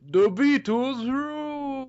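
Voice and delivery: high, drawn-out zany British attempt; zany botched accent. Shown as a demonstration. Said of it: "weird zany accents when I'm not trying".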